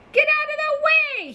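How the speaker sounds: high mock yell from the sheep; mild yell. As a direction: shout the sheep out of the way. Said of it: "he yells with a beep".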